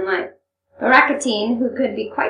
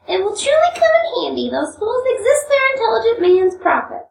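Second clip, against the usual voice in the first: much higher, loud, covering embarrassment with bravado; swaggering; 12 semitones higher, 4 dB louder than usual. Acted. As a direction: brag to hide the shame. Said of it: "disguising his embarrassment with a swagger".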